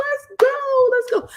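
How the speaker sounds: high, loud re-enacted battle cry; rallying cry. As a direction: call it out high, fired up to move. Her rallied reply to the sergeant.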